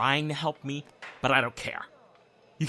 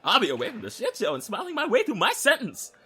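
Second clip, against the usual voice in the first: ordinary talk, nothing marked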